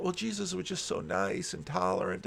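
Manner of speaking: softly parroting a naive opinion; naive believer. Retold or imitated. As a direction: imitate people gushing gently. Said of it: "sometimes people are like".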